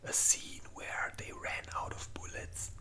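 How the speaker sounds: ordinary talk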